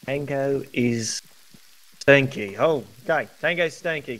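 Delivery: normal talking voice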